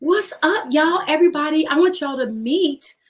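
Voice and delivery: high, bright announcing; excited announcement. Real, not acted. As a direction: hype up the crowd. Showing off her firstborn.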